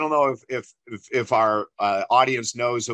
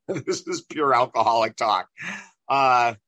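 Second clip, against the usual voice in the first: ordinary talk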